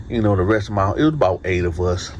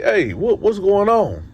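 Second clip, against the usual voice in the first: much higher, alarmed question; 11 semitones higher, slower than usual. Retold or imitated. Narrator seeing the guard press level one, much higher and alarmed. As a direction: realize you are headed to the hole. Elevator goes to the hole.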